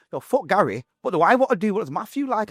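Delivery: suddenly high and loud, fired up; excited outburst. Retold or imitated. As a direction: burst out with fresh defiance. Excited outburst rediscovering himself.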